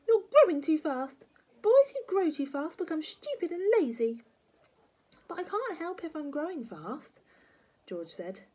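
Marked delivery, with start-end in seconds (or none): 0.1-4.2 high, loud crabby granny; piercing old nag; scolding grandma. Acted. 5.3-7.2 put-on plaintive boyish protest; protesting small boy. George protesting to grandma.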